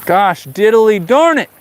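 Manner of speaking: frustrated at the fish again; mock cursing. Real, not acted. Final frustration.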